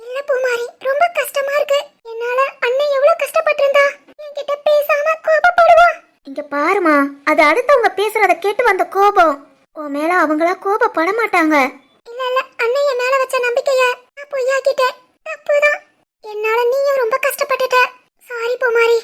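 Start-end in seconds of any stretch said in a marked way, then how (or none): 0.0-5.9 tearful sister blaming herself. Acted. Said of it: "நீயும் எவ்வளவு நேரம் தான் அழுவ". 12.1-19.0 higher, softer, on the verge of crying; tearful sister blaming herself. Acted. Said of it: "நீயும் எவ்வளவு நேரம் தான் அழுவ".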